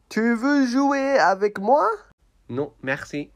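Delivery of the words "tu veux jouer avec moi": higher, pushier puppet plea. Acted. Puppet nags the elephant once more, higher. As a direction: nag like a small child.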